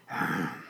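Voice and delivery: low, weary exhaled sigh; big sigh. Acted. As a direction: let out a great sigh. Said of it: "sighed a great big sigh".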